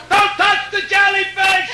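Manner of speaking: a mom hollering across the beach; mother yelling. Retold or imitated. Mother calling to her kid.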